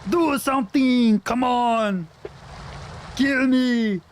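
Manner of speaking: a badly faked Austrian action hero, dragging each line out; bad Arnold Schwarzenegger impression. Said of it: "This is me doing a bad impression of an Austrian accent".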